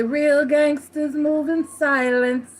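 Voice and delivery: saying quoted with emphasis. Acted. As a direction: stretch it out like a street motto. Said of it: "where we say".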